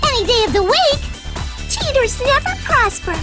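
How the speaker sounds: high, feisty comeback; spirited, high-pitched righteous defiance; defiant retort. Acted. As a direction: take up the challenge defiantly. Racer accepts villain's challenge.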